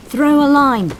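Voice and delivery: loud, high bellow across the water; full-voiced shouted order. A narrator's reading. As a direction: bellow to the sailors on the wreck. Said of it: "the coxswain bellowed".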